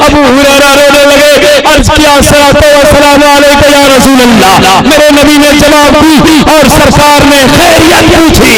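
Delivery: high, sustained climactic delivery; fervent preaching. Real, not acted. Preacher telling of the tearful greeting, fervent climax. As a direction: carry the story to its peak, high and full.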